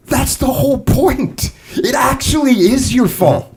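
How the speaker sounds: slow, emphatic, exasperated; frustrated insistence. Retold or imitated. Leader telling clients, frustrated and slow. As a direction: lean on every word like he's finally losing patience. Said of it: "maybe a little bit frustrated".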